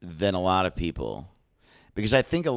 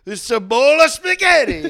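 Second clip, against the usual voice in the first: high, loud laughing Italian voice; mock Italian; 9 dB louder than usual. Introducing Mama's running mate, mock Italian. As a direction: present it like a proud politician.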